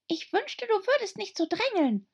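very high squeaky whisper; tiny squeaky complaint; whispering dormouse. A narrator's reading.

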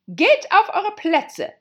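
high, very loud, drawn-out command; thundering. Acted. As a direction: thunder it across the field as queen. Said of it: "mit donnernder Stimme".

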